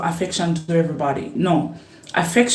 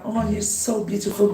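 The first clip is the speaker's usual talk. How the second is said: normal talking voice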